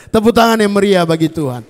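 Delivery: rally the crowd to clap; rousing call. Real, not acted. Preacher calling the congregation to loud applause.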